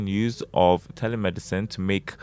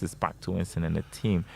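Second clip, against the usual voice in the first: ordinary talk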